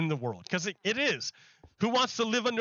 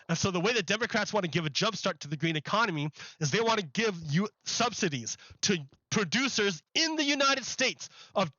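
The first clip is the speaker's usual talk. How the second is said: normal talking voice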